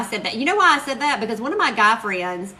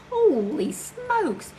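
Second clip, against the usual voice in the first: high, long drawn-out exclamation; amazed reaction; 9 semitones higher, much slower than usual. Retold or imitated. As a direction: stretch it out slowly in high awe. Retells amazement at viewer count.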